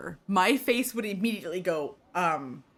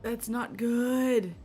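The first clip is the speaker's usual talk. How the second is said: slow, higher-pitched worried reaction; drawn-out dismay; 4 semitones higher, much slower than usual. Real, not acted. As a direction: draw the words out, pitched up.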